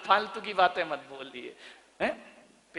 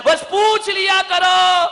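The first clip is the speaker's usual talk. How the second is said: preaching shout; 11 semitones higher, 11 dB louder, slower than usual. Real, not acted. Preacher urging daughters-in-law.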